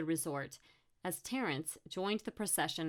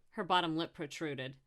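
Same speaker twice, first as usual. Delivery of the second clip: plain reading voice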